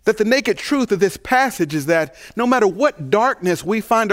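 building pulpit cadence; rising preaching. Acted. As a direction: lift the congregation with fervor. Preacher opens the sermon.

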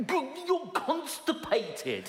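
slow, drawn-out laughing punchline; deadpan slow joke. Acted. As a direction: stretch it out for the laugh. Punchline about holding it in.

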